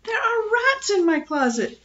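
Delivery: high-pitched wailing call for help; frantic calling. A narrator's reading. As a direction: call through the house in a panic.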